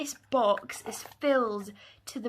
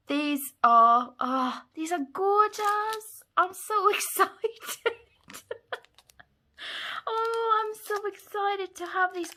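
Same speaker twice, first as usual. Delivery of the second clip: real excitement; 7 semitones higher, 6 dB louder than usual. Real, not acted. Crafter thanking friend for stamps, excited squeal. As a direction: gush in high excitement, breaking into laughter.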